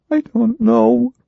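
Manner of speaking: put-on a scared girl barely answering; frightened young woman. Ginger to the hypnotist, high and frightened.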